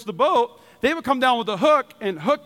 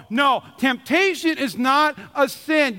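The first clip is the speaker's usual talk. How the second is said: ordinary talk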